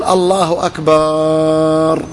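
الله أكبر with the final "baaar" held very long on one level note; very slow, last vowel of أكبر sustained; drawn-out takbir. Retold or imitated. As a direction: imitate an imam stretching the end of أكبر down into prostration. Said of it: "لا ينهي صوته إلا إذا سجد".

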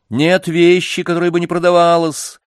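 insist, nothing is unsellable. A narrator's reading. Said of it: "настаивала дама".

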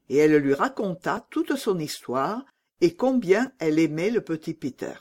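usual speaking voice

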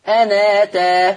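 أنا تا chanted slowly, the nun carrying the hamza's fatha; Quranic recitation with transfer. Shown as a demonstration. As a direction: recite أنا تا with naql, slow and sung. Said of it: "لما أجي أقرأها".

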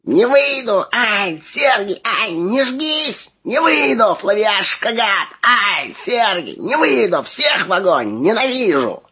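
very high, slow shrieking wail; terrible wail. Acted. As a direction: wail as a demon being cast out. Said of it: "Страшный вопль сотряс напряжённый воздух".

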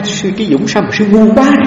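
mild retelling of the master's scolding; quoting the master. Retold or imitated. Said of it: "Ông nói". (nhại nhẹ lời thầy trách)

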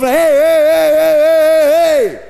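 high, loud hé shouts, slowing at the end; angry fan shout. Retold or imitated. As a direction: bark at the player through the screen. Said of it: "Weet wat je hoort". (boze supporter die naar de tv schreeuwt)

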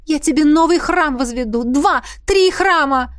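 high, urgent bargaining prayer; pleading, stretched out at the end; desperate plea. A narrator's reading. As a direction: implore with rising offers. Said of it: "взмолилась королева".

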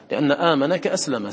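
ordinary talk, nothing marked

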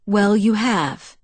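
slow, gentle reassurance; reassuring. A narrator's reading. Edward assures Bella she did a good job, reassuringly. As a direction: assure her, taking your time. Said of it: "Edward assured me".

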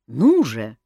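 loud, insistent prod. A narrator's reading. King demanding a vow from his cousin, insistent. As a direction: press hard for an answer. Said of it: "настойчиво повторил тот".

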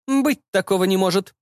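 high-pitched mother, categorical denial; mother firmly dismissing it in a high voice. A narrator's reading. Mother rejecting her son's claim, categorical. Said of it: "категорично заявила мама".

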